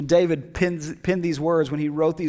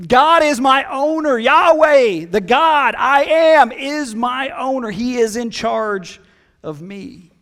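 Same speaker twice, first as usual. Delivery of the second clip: high, loud proclamation, slowing on the God I am; emphatic declaration; 6 semitones higher, 7 dB louder, slower than usual. Retold or imitated. Preacher voicing David declaring God his owner, loud and emphatic. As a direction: proclaim it to the whole world. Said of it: "an emphatic statement to the world".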